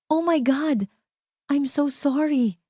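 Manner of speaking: high, overdone gasp of apology; fake shock. A narrator's reading. After spilling wine on Ara, Isabel fakes shocked apology.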